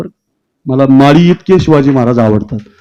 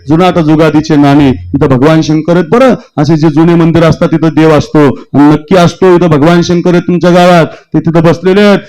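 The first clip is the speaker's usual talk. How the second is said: ordinary talk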